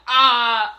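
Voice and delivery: loud, sustained "ah" cry; short acted scream; retold scream. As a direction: re-enact a startled pain scream, loudly. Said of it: "I start screaming".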